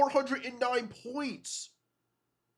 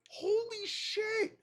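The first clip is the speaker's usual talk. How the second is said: high amazed gasp; 9 semitones higher, slower than usual. Real, not acted. Reacting to blowout score.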